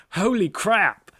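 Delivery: loud, slow, mock American exclamation; lightly hammed-up American accent. Reading a viewer's comment aloud, loud slow American accent. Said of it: "I don't know why I'm putting this accent".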